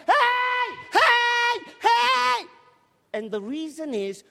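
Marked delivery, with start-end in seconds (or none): 0.1-2.5 loud shouts across the pitch; hammed-up football shouting. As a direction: yell for the ball, high and loud.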